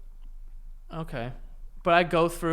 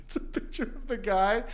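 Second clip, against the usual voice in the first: ordinary delivery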